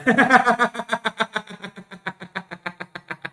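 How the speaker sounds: drawn-out high staccato laugh; high laughter slowly running down; long laugh. Real, not acted. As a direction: keep cackling till it fades. Laughs after dismissing the idea.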